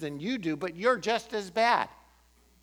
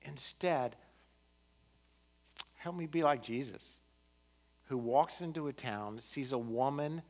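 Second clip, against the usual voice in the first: quiet prayer; 6 semitones lower, 8 dB quieter than usual. Acted. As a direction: pray gently, almost to yourself. Leads closing prayer.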